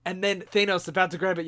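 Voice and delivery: ordinary talk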